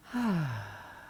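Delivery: long soft exhale through the mouth; audible sigh. Shown as a demonstration. Guide showing listeners how to sigh, long and relaxed. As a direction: let out a slow, falling sigh. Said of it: "take a couple of sighs, audible or quiet".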